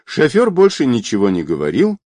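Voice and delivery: plain reading voice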